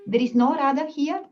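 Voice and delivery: emphatic accusation. Retold or imitated. Yamuna proving Krishna an impostor, emphatic accusation.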